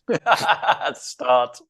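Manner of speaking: said through a burst of laughter; amused, laughing as you speak. Real, not acted. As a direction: break into laughter mid-line. Laughs recalling the cold.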